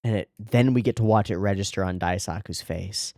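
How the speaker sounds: usual speaking voice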